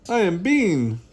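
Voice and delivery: loud, high boisterous announcement; big storybook yell; shouting. A narrator's reading. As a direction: shout your name proudly. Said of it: "Bean shouts".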